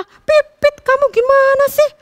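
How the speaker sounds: high, loud furious berating; trapped bulbul yelling at the sparrow, high and loud; angry scolding. A narrator's reading. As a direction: tear into the clumsy sparrow. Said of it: "Kutilang semakin marah".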